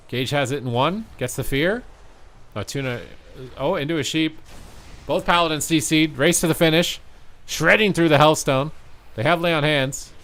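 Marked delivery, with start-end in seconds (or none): 3.6-10.1 high, loud, racing to the finish; excited play-by-play. Real, not acted. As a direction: call the fight rising with the action. Fight heating up, rising call.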